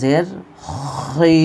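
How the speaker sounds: খই said very slowly, thick and full-mouthed; heavy khi. Shown as a demonstration. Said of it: "মোটা করে উচ্চারণ করতে হবে".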